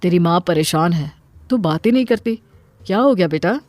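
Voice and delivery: concerned father. Acted. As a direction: press her gently as a worried dad. Father speaks to Minni. (चिंतित पिता की भारी आवाज़)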